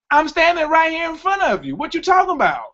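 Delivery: can't believe what he's hearing; incredulous. Real, not acted. Incredulous at woman's excuse.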